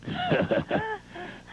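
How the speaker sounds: squeaky high-pitched laugh; high cackle. Real, not acted. Laughs at his own joke.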